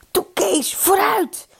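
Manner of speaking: very high, urging cry; high-pitched eager prodding; excited calling. A narrator's reading. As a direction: egg him on excitedly. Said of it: "riep Eddy opgewonden".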